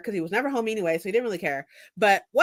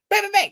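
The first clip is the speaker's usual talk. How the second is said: imitated banging; 9 semitones higher, 5 dB louder than usual. Banging again, retold.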